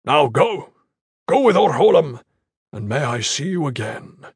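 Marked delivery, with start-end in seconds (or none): 0.0-2.2 loud, ringing order to go; commanding send-off. Acted. Iron Fist dismisses his squad with a blessing, loudly. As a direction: send them off with force.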